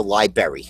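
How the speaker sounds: library as LIE-berry; wrong library. Shown as a demonstration. Co-host joking with a mistake, LIE-berry for library. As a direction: drop the first R in library. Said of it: "And that's wrong".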